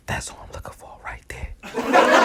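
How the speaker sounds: hushed excited aside; whispered aside. Retold or imitated. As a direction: mutter it under your breath. Spotting Dre, aside.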